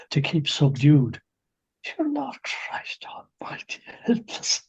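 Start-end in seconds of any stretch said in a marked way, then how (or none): 1.8-4.6 high, whispered plea to heaven; exasperated whisper. Real, not acted. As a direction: whisper it to God in disbelief.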